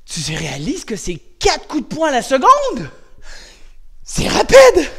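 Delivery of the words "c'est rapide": excited. Real, not acted. Impressed at punch speed.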